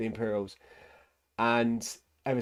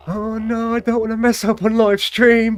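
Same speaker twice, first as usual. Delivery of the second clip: high, loud panicky squeal; mock panic; 12 semitones higher, 11 dB louder than usual. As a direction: squeal it like a nervous wreck.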